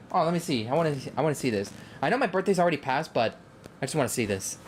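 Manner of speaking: ordinary talk, nothing marked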